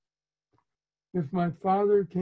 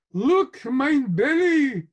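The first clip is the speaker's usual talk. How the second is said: put-on high, loud, slow Yiddish-accented call; mock Yiddish mama calling her son; thick pretend Yiddish accent; 9 semitones higher, 7 dB louder, slower than usual. A narrator's reading. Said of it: "calls out in thick, pretend Yiddish accent".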